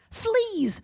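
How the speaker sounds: a sudden high cry of disgust; cried out. Acted. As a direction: yelp it high, shocked. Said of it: "she cried".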